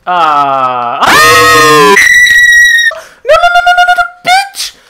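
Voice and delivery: raging high-pitched scream. Real, not acted. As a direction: scream in gamer fury. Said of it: "does not like my screaming".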